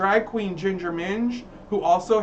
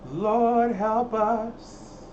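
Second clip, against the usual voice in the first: slow drawn-out pleading prayer; mock prayer; 3 semitones higher, much slower than usual. Praying over the troll, drawn out slowly. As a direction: stretch it like a church plea.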